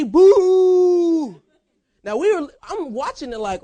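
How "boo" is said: loud, drawn-out boo, higher and louder; crowd booing. Retold or imitated. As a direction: boo him like the whole stadium. Fans booing the quarterback.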